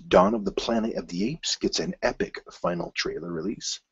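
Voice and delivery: normal talking voice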